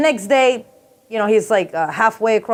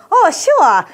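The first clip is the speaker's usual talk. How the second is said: high, loud mock server's reply; Korean restaurant server; 8 semitones higher, 5 dB louder than usual. Server takes a diner's hamburger order, high mock agreement. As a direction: chirp it back as the eager server. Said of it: "And they're like".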